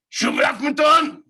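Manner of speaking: high, loud mock scream at a stranger. Stranger on the bus screaming a correction at him. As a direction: yell it high as a crazed man on the bus. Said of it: "He starts screaming at me".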